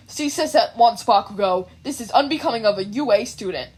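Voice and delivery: a hint of a strict class president; stern class rep. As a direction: scold him by the book. Ida reprimands Bakugo.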